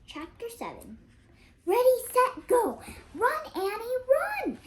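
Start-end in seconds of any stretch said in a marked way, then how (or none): 1.6-4.6 loud, high shout to run; crying out. Acted. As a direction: yell at Annie to run for her life. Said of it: "cried Jack".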